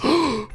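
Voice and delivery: sharp high gasp; sudden startled intake; shocked gasp. Real, not acted. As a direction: suck in air in surprise. Shocked at hearing Kevin's name.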